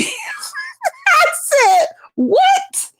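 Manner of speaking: very high, laughing "What?"; high squeaky laughing disbelief; shocked squeal. Real, not acted.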